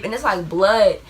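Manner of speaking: ordinary delivery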